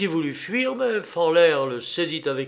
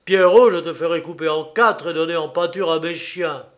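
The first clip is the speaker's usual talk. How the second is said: loud, angry outburst; furious threat; 3 semitones higher, 8 dB louder than usual. A narrator's reading. Enraged king threatening his insolent subject, furious threat. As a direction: threaten him at full voice. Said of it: "se mit dans une colère furieuse".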